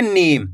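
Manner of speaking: नीम with the ee stretched long; नीम, long ee before the m; drawn-out long vowel. Shown as a demonstration. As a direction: pull out the ee in नीम. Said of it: "तो आपको खींच के पढ़ना है".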